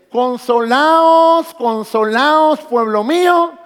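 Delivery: loud, high and very slow, drawn out; booming proclamation. Acted. Pastor voicing God's call to his people, booming and slow. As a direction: proclaim it like a prophet raising his voice. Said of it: "y dice".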